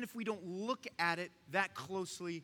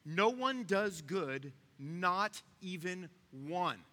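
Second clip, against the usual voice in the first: very slow, each word set apart; weighty, drawn-out scripture quote; slow emphatic verse; much slower than usual. Real, not acted. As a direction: spell it out deliberately, pausing before NOT EVEN ONE. Reads verse emphatically, repeats it plainly later.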